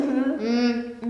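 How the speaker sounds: wordless mouth-full "mm"; muffled storybook hum in reply; mumble. A narrator's reading. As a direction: mumble through closed lips. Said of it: "was all she could mumble".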